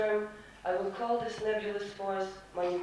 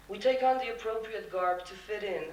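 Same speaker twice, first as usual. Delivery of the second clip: usual speaking voice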